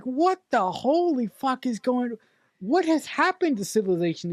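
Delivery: aghast movie-watcher reaction; mock shocked. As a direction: stretch it out in appalled amazement. Retold shocked reaction to film.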